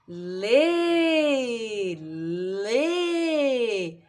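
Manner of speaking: "lê" held long on the vowel; drawn-out syllable. Shown as a demonstration. Teacher helping a child hear sounds, long sustained "lê". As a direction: stretch each "lê" so every sound is audible. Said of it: "Pra ficar mais audível pra ela".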